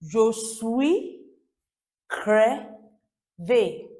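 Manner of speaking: "Je suis" rising, long pause, "crevé" falling; model for repeating. Shown as a demonstration. Teacher handing over to learners, "Je suis crevé" slowly and clearly. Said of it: "Over to you".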